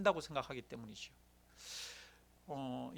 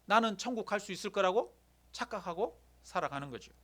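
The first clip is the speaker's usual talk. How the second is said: normal talking voice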